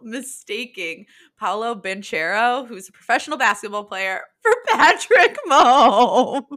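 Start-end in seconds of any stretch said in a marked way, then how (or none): 4.4-6.6 laughing. Real, not acted. Laughing at Brundle's mistake.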